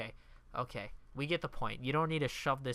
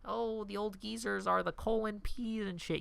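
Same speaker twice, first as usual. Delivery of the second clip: mocking voice; 6 semitones higher than usual. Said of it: "'Cause, like".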